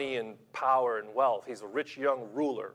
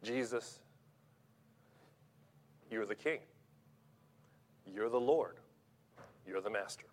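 soft, hushed worship, much softer than the lines around it; reverent confession; 6 semitones lower, 9 dB quieter than usual. Pretend. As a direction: breathe it out like a quiet prayer of surrender. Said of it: "Or you can say".

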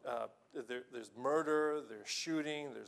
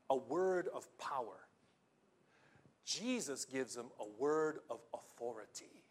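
usual speaking voice

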